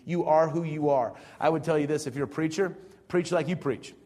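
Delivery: preaching at full force; forceful exhortation. Real, not acted. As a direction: drive it home to the preachers.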